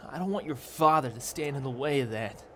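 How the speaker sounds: put-on high-pitched, impassioned young lover; urgent pleading. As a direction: plead your case, high and insistent.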